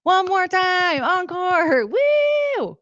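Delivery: high excited mock cheer; hammed-up yay and woo. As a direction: whoop like a fan demanding an encore. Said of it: "I was gonna be like".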